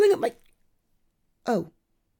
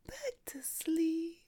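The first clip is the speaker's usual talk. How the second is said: drawn out, higher whispered back to sleep; slow the lull right down; slower, higher crooning whisper; slow soothing whisper; 9 semitones higher, 10 dB quieter, slower than usual. Acted.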